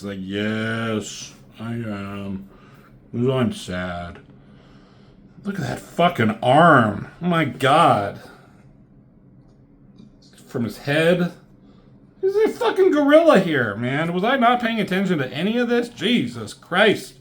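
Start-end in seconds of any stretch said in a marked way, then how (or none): 0.2-4.2 slow, low, soft mopey whine; mock sad. Rogue answering Magneto about Jean, mopey mock-sad voice. 12.2-17.1 much higher, incredulous outburst; real disbelief. Real, not acted. Reviewer shocked at Magneto's gorilla arms, incredulous high outburst.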